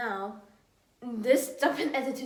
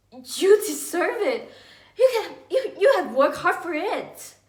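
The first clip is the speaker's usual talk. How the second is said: louder, higher-pitched acted persuasion; insistent urging; 6 semitones higher, 9 dB louder than usual. Adult child persuading mom that it is deserved, insistent. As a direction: press the point with raised pitch and volume.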